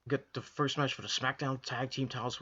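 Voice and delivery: ordinary talk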